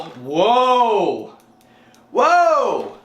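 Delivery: sudden real surprise, high pitched; surprised whoa. Real, not acted. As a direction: caught off guard mid-sentence. Surprised at something while talking.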